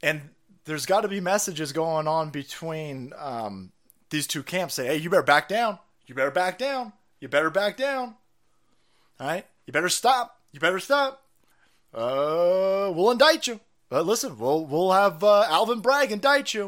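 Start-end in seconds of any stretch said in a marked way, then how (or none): 4.9-16.7 mock threat. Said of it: "voicing threatening camp".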